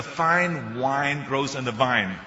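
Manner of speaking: slow, careful "Fine wine grows in the vine"; diction drill. Shown as a demonstration. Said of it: "difficulties with the F and the W and the V".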